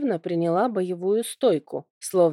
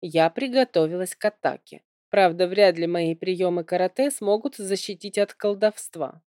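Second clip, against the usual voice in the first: plain reading voice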